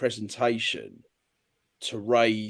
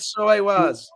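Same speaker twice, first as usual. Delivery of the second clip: high, louder mock Northern Irish accent; 10 semitones higher, 5 dB louder, slower than usual. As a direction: put on an exaggerated Northern Irish lilt, pitched up. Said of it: "Northern Irish accent".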